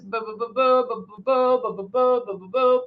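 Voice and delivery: light, steady repeated boo to show the rhythm; mimicked airbrush passes. Shown as a demonstration. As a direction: tap out the spray passes with your voice. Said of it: "You wanna make passes".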